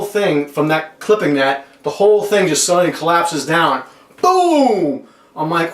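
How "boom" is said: high, drawn-out falling boom; imitated crash boom. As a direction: mimic a big collapse with a long boom.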